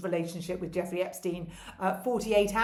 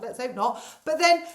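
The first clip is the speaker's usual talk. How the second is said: usual speaking voice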